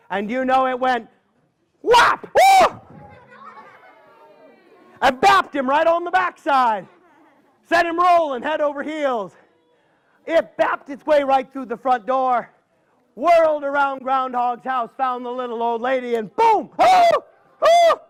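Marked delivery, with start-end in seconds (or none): 1.8-2.3 shouted, high whap as the gourd strikes; loud whap. Acted. 2.3-2.8 loud, very high ooh of pain; a yelp as the gourd strikes home. Acted. Groundhog gets hit. 16.8-18.0 loud, very high yelps of pain; old lady yelping. Acted. Gourd hits the old lady.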